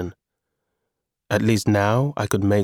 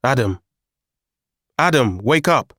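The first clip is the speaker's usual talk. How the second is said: shouted; 3 semitones higher, 3 dB louder than usual. A narrator's reading. Shaking his motionless brother, alarmed shout. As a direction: shout to wake someone who won't stir. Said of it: "I shouted".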